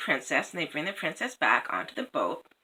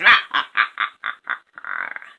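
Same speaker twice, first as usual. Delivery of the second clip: low raven chuckle; 6 dB louder than usual. A narrator's reading. Raven laughs.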